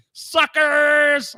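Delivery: high, loud drawn-out jeer; taunting shout. Acted. Host jeering at people who own nothing, taunting shout. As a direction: call it out to mock them. Taunting the streamers he just mocked.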